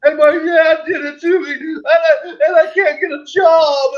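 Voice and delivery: put-on the girl grown up, blaming her dad; mock traumatized kid. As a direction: whine like a scarred child.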